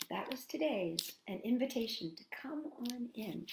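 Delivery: unvoiced whisper of the invitation; whispered recap. Librarian whispers the invitation to the kids. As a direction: breathe it out softly.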